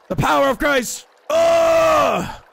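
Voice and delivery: mock exorcist.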